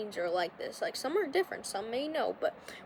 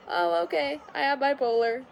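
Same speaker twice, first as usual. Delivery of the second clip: mock self-diagnosis; 4 semitones higher, 5 dB louder than usual. Mimics a casual self-diagnosis.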